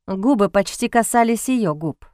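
ordinary delivery, nothing marked

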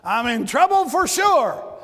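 alarmed cry. Retold or imitated. Retold panicked thought at fire.

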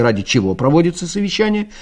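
usual speaking voice

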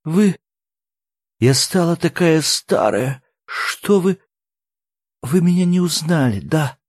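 high, pleading voice through tears; tearful. A narrator's reading. Old woman asks if they failed to recognise her, tearful. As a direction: plead as if choking back tears. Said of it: "сквозь душившие её слёзы".

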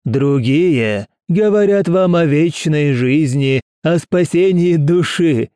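slow, high, fervent preaching; thundering sermon. Acted. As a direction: preach slowly with fanatic fervour. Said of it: "в гремящую проповедь".

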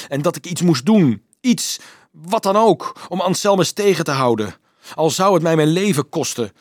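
high, loud, desperate outpouring; anguished confession. A narrator's reading. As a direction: build it with desperate resolve. Johannes' anguished confession to Dolf. (wanhopig, hoog en luid opbiechten)

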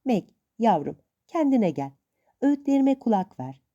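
plain reading voice

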